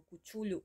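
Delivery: Cuchulho, with a sh sound and lh; alternative pronunciation. Shown as a demonstration. Vlogger listing guesses at a monster's name, alternative pronunciation Cuchulho. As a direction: offer Cuchulho as yet another guess. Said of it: "ninguém nunca pronuncia aquela porra certa".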